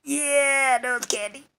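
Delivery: high, thin strained voice; imitating Lucifer Star. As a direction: squeeze it out in a high mock voice. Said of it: "Lucifer Star was like".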